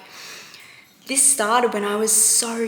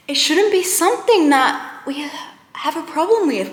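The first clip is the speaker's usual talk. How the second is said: louder and higher, exasperated; angry outburst; 6 semitones higher, 6 dB louder than usual. Real, not acted. To viewers, venting about food struggles, angrily raised voice. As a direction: let the frustration burst out. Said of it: "it just makes me angry".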